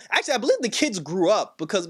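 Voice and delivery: usual speaking voice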